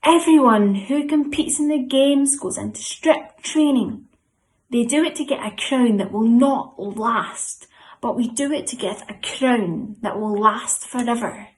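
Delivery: loud, high-starting group-leader recitation; really loud. Shown as a demonstration. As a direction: belt out the verse for everyone to join in. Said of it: "we're gonna say it really, really loud".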